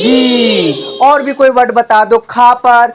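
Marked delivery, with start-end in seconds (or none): 0.0-1.0 जी with a very long "ee"; जी, sustained as a model for pupils; drawn-out vowel. Shown as a demonstration. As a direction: stretch the vowel of जी. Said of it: "थोड़ा खींच कर बोलेंगे".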